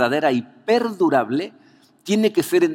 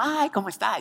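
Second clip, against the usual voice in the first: cheerful shop lady; 7 semitones higher, 4 dB quieter than usual. Retold or imitated. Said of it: "Muy sonriente todo el tiempo".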